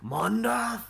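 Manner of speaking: put-on Irish-Scottish accent. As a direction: point at the cave in an Irish-Scottish lilt. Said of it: "the one with the Irish Scottish accent".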